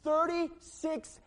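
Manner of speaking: loud, high, driving pulpit preaching; preaching at full force. Acted. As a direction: preach it with fire to the whole church.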